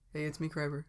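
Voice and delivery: soft, strange breathy voice; badly disguised, airy voice; Kreiber. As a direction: pretend guest in a hushed, breathy put-on voice. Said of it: "like a strange, breathy voice".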